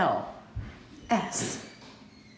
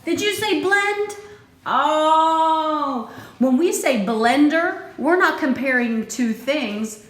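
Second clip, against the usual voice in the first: excited reveal; 8 semitones higher, 7 dB louder, slower than usual. Acted. Revealing the final answer.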